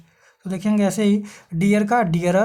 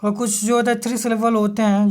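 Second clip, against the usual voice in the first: ordinary talk, nothing marked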